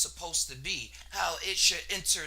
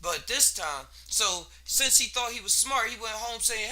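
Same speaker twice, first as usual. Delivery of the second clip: ordinary delivery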